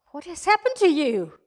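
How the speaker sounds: loud, high, swooping question; alarmed amazement, voice leaping up then falling; astonished outcry. Acted. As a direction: cry out in amazement at your husband. Astonished wife questions mute husband.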